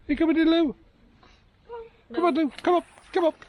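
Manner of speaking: high coaxing call. Real, not acted. Coaxing second dog.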